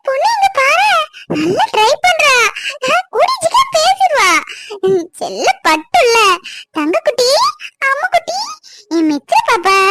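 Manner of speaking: put-on very high, squealing baby talk. Aunt praises baby Mithra, very high squealing baby talk. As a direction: squeal with delight over the baby.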